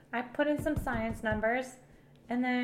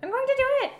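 shout; 13 semitones higher, 8 dB louder, faster than usual. Real, not acted. Snapping back at pushy friend.

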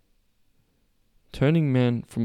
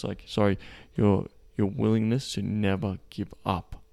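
normal talking voice

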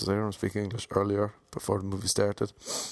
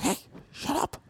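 squeaky hushed hey. Retold or imitated. Retold whisper to couple.

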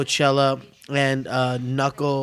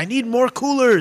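higher-pitched mock kitchen yell; retold yell; 9 semitones higher than usual. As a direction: yell out a demand, half joking. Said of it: "And I just kept yelling".

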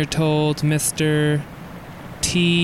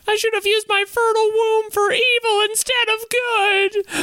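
much higher, bitter housewife voice; shrill wife voice. Acted. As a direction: play the fed-up wife, voice pitched way up. Wife voice continues.

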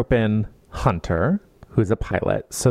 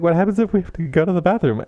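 higher, rushed anxious question; hint of ditzy newbie; 6 semitones higher, much faster than usual. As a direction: rattle it off high like a nervous newbie. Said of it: "And Helen is like".

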